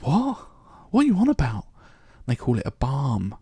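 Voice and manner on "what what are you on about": high-pitched incredulous outcry; baffled, indignant mock protest; mock uproar. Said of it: "it can cause uproar".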